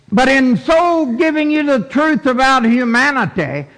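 high, forceful preacher's delivery; preaching. Acted. Preacher voicing God's promise to you and me, forceful preaching.

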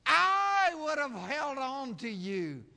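high, loud, soaring sermon cry; preaching at full force. Acted. Preacher pleading with the congregation, preaching at full force. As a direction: preach it out, voice climbing and ringing.

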